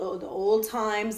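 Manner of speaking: ordinary delivery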